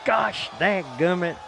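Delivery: mock hillbilly cussing; folksy exclamation. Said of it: "I gotta stick to dadgummit".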